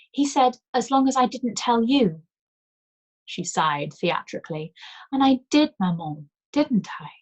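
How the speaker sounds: high loud little girl, hammed guilt; theatrical child. A narrator's reading. Child Anouk confessing theatrically.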